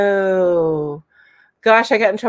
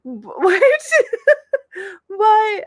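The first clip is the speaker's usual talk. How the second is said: laughing; 10 semitones higher than usual. Real, not acted. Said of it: "I'm laughing".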